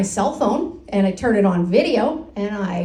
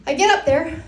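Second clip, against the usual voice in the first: normal talking voice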